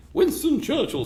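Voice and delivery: high, outraged lady's voice, mock; hammed-up scandalised woman calling him out; indignant lady. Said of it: "from some lady".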